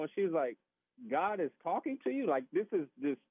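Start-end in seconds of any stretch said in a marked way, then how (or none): 1.1-2.3 imitated disbelief, pitched high; retold incredulous reaction; blown away. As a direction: echo a stunned wife's doubtful question. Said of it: "she's just totally blown away".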